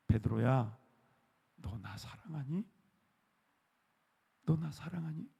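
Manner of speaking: soft, hushed, near-whispered asking; tender question. Retold or imitated. Jesus softly asking Peter, hushed and tender. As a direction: gently ask if he is still loved. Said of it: "그걸 질문하신 거예요".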